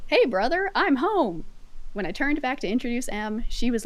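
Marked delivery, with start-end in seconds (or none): 0.1-1.4 cheerful brother. Acted. Brother greets in the story.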